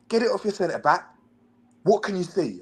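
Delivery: high mock coach, lecturing; angry coach. Coach telling player the pass is risky, high mock lecture. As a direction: lecture him like a worked-up coach. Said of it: "He was like".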